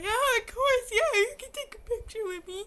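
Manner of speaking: high, loud imitation of herself crying; mock tearful squeal. Tearful fan agrees to a picture, high mock-crying squeal. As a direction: squeal it out as if overcome with tears. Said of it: "'Cause I was crying".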